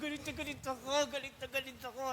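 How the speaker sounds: soft, choked hurt confession; hurt teenage voice. Retold or imitated. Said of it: "Sabi ko ganyan". (mahina at may hinanakit na pag-amin ng binatilyo)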